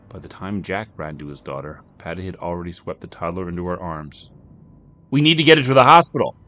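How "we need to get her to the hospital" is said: high, loud, fast panic; a mother desperate to reach the hospital; panicked cry. Acted. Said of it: "Patty cried".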